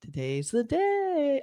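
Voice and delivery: drawn-out sinister whisper-like promise; creepy trailer voice. Retold or imitated. Host quoting the trailer woman's voice, slow eerie. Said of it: "I think her voice going".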